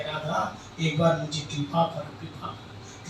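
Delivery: tender plea. Acted. Krishna pleading at Radha's feet, soft to whisper. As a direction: beg for grace, dying away.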